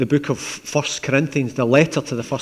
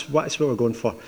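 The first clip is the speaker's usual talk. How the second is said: ordinary talk, nothing marked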